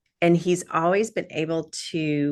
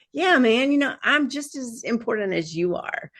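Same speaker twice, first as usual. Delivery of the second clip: mock swagger; 8 semitones higher than usual. Voicing her defiant stance.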